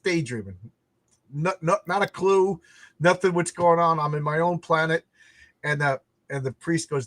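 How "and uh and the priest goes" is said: normal talking voice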